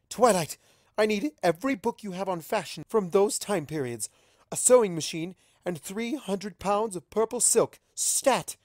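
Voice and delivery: loud, high, rushed fashion frenzy; barking orders like a manic designer; frantic excitement. Acted. Rarity barks frantic orders at Twilight.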